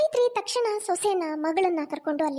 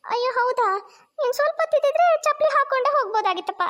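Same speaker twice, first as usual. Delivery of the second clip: put-on higher, carefree young wife; higher, innocent bride voice; naive daughter-in-law; 7 semitones higher, 4 dB louder than usual. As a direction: answer cheerfully, missing the point.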